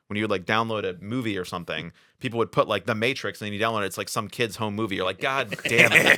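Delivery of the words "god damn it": slow, mildly exasperated curse; louder, drawn-out groan of a curse; retold exasperation. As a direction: drag it out in mock frustration. Letdown after fake movie download.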